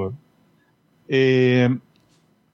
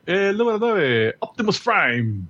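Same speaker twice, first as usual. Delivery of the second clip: countdown announcer; 5 semitones higher, slower than usual. Acted.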